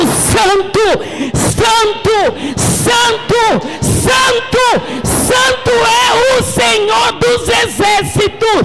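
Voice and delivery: loud, high preacher's proclamation; ringing cry of worship; angels crying out. Acted. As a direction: shout it out as the angels' praise, drawing out the words. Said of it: "anjos gritando".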